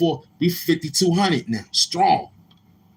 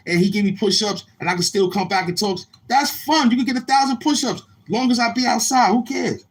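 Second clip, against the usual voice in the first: hyped up; 8 semitones higher than usual. Real, not acted. Hyping up the chat about push-ups.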